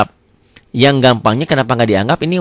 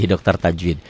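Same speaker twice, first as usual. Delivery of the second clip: normal talking voice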